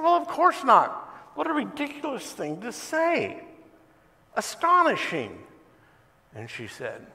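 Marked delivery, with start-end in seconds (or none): 0.0-5.5 much higher, scoffing outrage; indignant disbelief. Retold or imitated. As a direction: scoff as if the question is absurd. Atheist indignant at her question.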